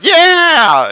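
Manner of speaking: a high, loud whoop of a cheering crowd. Retold or imitated. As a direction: cheer like the whole room just went wild. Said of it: "and, like, cheering".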